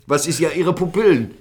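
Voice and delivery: questioning police officer. Retold or imitated. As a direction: lightly imitate an officer querying a suspect. Retold officer questions his pupils.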